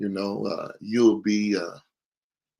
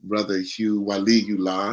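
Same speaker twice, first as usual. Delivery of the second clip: normal talking voice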